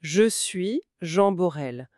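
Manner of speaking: slow, every syllable of Je suis Jean Borel clear; careful teacher repeat of Je suis Jean Borel. Shown as a demonstration. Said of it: "Écoutez encore".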